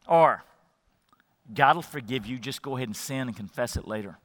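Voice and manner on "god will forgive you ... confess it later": very soft, low and quick; hushed, low insinuation; tempting inner voice. Acted. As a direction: murmur temptation into the listener's ear. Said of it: "what it's gonna sound like in your ears".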